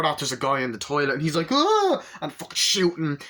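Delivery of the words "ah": high yelp of a startled man; a sudden shrill squawk of alarm; mock scream. Guy in the toilet startled by Arnie, mock scream.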